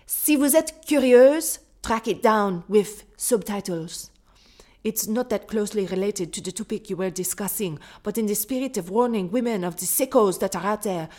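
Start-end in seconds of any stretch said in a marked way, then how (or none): 0.1-1.6 slow, louder French, pitched higher; French accent. Acted. Host reads the Quebec listener's French, slow and accented. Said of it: "I can't do the French accent anymore". 1.8-9.8 put-on whispered, accent slipping away; drifting accent whisper. Host loses the French accent mid-email, whispering. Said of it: "What accent am I doing in the end?".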